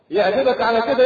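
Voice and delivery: ordinary talk, nothing marked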